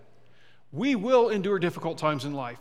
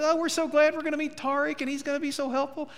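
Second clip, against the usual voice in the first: excited; 9 semitones higher than usual. Retold or imitated. Two flippers gushing about meeting Tariq, excited. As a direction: gush like eager new house flippers. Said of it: "they were all excited".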